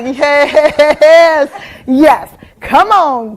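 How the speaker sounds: loud, slow cheering from the stands; proud sideline mom cheering. Retold or imitated. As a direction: hype your kid up loudly.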